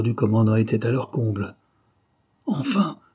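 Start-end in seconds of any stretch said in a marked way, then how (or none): 2.5-3.0 high sudden outburst; impatient commander finally exclaiming; relieved exclamation. A narrator's reading. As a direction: throw out a relieved at last. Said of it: "lança-t-il".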